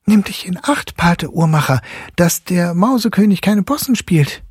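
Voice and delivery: put-on high, teasing boy's warning; boy calling out. A narrator's reading. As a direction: call out a warning playfully. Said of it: "da rief Fritz".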